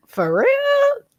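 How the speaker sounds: high squeaky for real; sarcastic disbelief. Retold or imitated. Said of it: "And I'm like".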